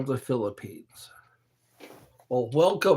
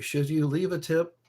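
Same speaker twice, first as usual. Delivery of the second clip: ordinary delivery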